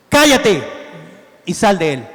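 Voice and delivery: a loud, sharp rebuke; stern command. Acted. Jesus rebuking the demon with a loud command. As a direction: order the spirit out with force. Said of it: "Creo que estaba fuerte".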